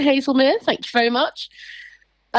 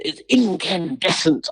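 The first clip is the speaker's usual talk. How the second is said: slow, plummy royal pronouncement; Prince Charles; 3 semitones lower, slower than usual. Retold or imitated. Imitating Charles calling himself incandescent. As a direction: drawl it like a prince. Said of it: "Prince Charles who goes".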